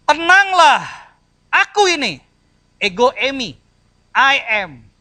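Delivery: high, loud calming command; commanding Jesus. Acted. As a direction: call out over the storm to reassure. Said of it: "Yesus berkata kepada mereka". (Yesus berseru menenangkan murid)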